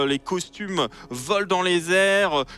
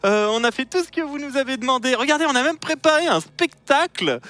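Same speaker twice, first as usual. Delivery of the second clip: much higher, louder, fawning chatter; high nervous voice; 8 semitones higher, 3 dB louder than usual. Acted. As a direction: babble nervously to please the dragon.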